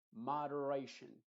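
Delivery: moderation dicho despacio, sílaba a sílaba; sílaba por sílaba. Shown as a demonstration. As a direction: separa cada sílaba de moderation. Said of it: "lo pronuncies sílaba por sílaba". (pronunciando moderation sílaba por sílaba)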